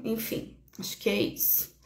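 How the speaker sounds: soft unvoiced whisper; whispered trail-off. Real, not acted. Wrapping up her point to viewers, whispered. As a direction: let it fade to a breath.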